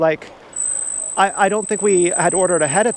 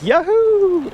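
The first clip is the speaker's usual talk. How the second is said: high, drawn-out yahoo of relief; celebratory whoop; 13 semitones higher, 3 dB louder than usual. Retold or imitated. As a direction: whoop it out like you just got great news. Acting out his relieved cheer.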